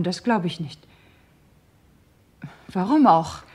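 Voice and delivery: ordinary talk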